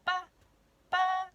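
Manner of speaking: high, loud bird chirp; chirping chick. Acted. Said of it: "she chirped".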